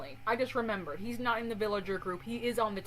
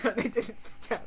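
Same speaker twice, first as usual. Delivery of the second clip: ordinary talk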